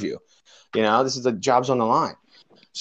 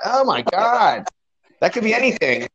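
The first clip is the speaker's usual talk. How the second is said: appalled; 9 semitones higher than usual. Retold or imitated. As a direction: retell an aghast reaction. Retelling his disgusted reaction to vomit.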